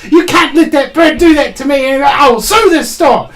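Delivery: hammed-up furious customer; angry complaint. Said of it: "she's really pissed off".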